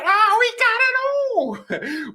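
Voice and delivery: very high, drawn-out cry of delight; ecstatic outburst. Real, not acted. Fan celebrating a versatile new signing, ecstatic cry. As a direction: burst out in a high, slow wail.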